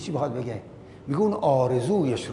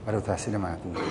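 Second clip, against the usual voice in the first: low, much softer voice; quiet repeat; 8 semitones lower, 9 dB quieter, faster than usual. Real, not acted. Lecturer repeats his concession to the listeners, low and quiet. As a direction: say it low, as an afterthought.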